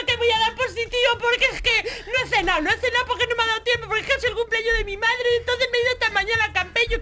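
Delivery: high, loud, laughing panic; panicked crying. Retold or imitated. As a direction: blurt the confession in a teary rush. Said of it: "Yo empiezo a llorar y digo". (confesándose al policía entre llanto y risa, aguda y fuerte)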